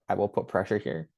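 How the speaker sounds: usual speaking voice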